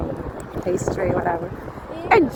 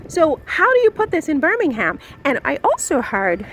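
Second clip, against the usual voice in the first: fast, higher excited rant; speaking very fast; 9 semitones higher, faster than usual. Real, not acted. Ranting to viewers about outfits without Megan, fast and high. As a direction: rattle it off as it races away from you. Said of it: "I'm speaking very fast".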